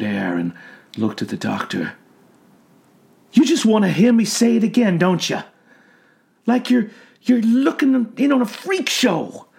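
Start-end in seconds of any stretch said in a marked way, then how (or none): 3.3-9.5 raised, fast and resentful; bitter old man. A narrator's reading. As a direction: lash out at the doctor.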